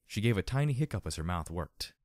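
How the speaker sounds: usual speaking voice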